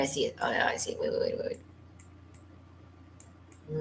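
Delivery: hushed, rapid muttering; real absent-minded whisper; whispering to self. Real, not acted. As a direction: whisper it under your breath while searching. Muttering to self while searching.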